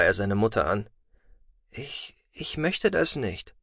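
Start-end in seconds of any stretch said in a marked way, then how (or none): 1.7-3.5 soft, slow, hesitant plea; timid. Acted. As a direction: stammer it out helplessly. Helpless son pleading mother.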